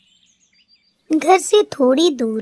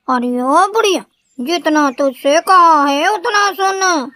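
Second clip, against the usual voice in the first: put-on higher, loud, scornful bahu shouting down the old woman; rude daughter-in-law; 4 semitones higher, 4 dB louder than usual. A narrator's reading. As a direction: tell the old woman off with contempt. Daughter-in-law snaps again. (घमंडी बहू की ऊँची, तीखी डाँट)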